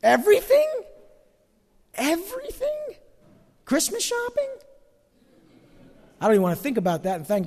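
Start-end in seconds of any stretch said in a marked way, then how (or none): 0.0-4.5 much higher, squeaky whine of disbelief; hammed-up horrified whine; mock disbelief. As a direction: whimper it like you can't believe the rule. Protesting the verse in disbelief.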